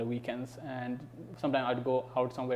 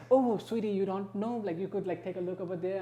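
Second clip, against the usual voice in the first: higher, softening mock-sweet voice; sugary helper; 6 semitones higher than usual. American brushing off someone who is lost, mock-sweet. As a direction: imitate a condescendingly sweet helper.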